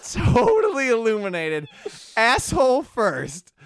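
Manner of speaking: rising to a shout; loud dramatic build. Retold or imitated. Dramatic reveal in the story.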